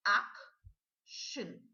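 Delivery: "Action" said loud and clear as AK-shun; model word. Shown as a demonstration. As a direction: stress AK in "Action", end on shun. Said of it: "the way I pronounce the shin".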